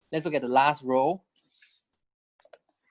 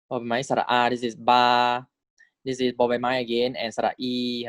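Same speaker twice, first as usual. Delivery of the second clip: ordinary talk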